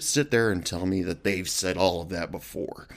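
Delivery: usual speaking voice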